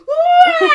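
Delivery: piercing high, very loud squeal; mock screaming. Someone is doing a dance and Ariel squeals, exaggerated scream. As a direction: shriek at full volume, mocking. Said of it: "she's just, like, screaming".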